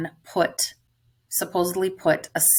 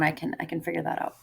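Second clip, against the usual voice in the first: normal talking voice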